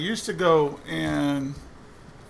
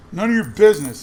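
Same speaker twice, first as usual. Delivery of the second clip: angry retort; 3 semitones higher, 8 dB louder than usual. Retold or imitated. Angry man snaps back in line.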